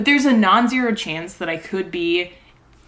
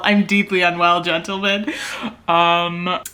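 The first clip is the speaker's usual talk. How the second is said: normal talking voice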